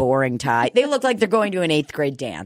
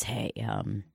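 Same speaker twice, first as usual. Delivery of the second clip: low, hushed, much softer woman's warning; lowered secretive voice; quiet warning; 10 semitones lower, 9 dB quieter than usual. Retold or imitated. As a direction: confide the danger in a low murmur. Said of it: "she goes".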